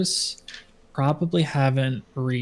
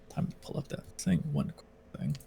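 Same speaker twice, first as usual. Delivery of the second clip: whispered aside; 12 dB quieter than usual. Real, not acted. DM aside while checking notes.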